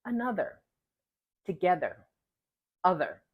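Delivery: another, together, other with a voiced th; careful voiced th in the middle; exaggerated th practice. Shown as a demonstration. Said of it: "The medial position".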